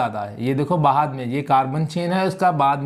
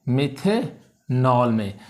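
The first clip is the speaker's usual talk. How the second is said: Methanol split slowly, मिथे held, then नॉल falling; syllable by syllable; 3 semitones lower, much slower than usual. Shown as a demonstration. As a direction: spell out methanol slowly for the class. Teacher sounds out the term.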